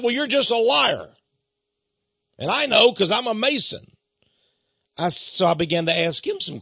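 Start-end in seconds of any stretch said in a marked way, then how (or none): 0.0-3.8 raised, higher indignant voice; imitated heated accusation; angry caller. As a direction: act out an irate man insisting loudly. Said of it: "He was angry".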